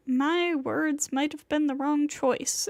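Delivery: ordinary delivery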